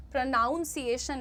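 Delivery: प्रोनाउंसिएशन with nown as in pronounce; wrong vowel. Shown as a demonstration. Teacher warning students off the mistake, nown in प्रोनाउंसिएशन. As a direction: say प्रोनाउंसिएशन as pro-NOWN-see-ay-shun. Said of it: "ना बोलिएगा please".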